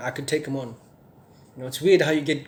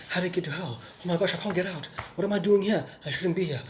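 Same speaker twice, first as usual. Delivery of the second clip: retold panic; 4 semitones higher than usual. Trapped in hell, panicking.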